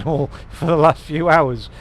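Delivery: loud laughter through the words; laughing. Real, not acted. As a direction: laugh at yourself while talking. Laughing at himself.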